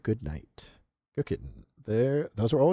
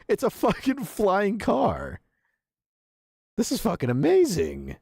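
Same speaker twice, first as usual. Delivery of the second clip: excited awe; 11 semitones higher than usual. Real, not acted. Streamer awed by a flying car, high excited delight. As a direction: savour every word of it.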